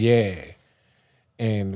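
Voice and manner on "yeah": imitated New Orleans "yeah"; New Orleans accent. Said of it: "talking like I'm from New Orleans".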